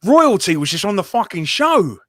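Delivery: excited exclamation. Real, not acted. Excited about guest.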